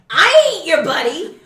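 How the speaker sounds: loud, high retort at the thief; indignant yell. Retold or imitated. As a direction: bark it back at him. Confronting car thief.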